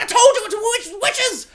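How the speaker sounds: very high, loud shriek of witches. Retold or imitated. Brother warning the family about witches, a high shriek. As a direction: scream the warning at everyone. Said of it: "And he's like".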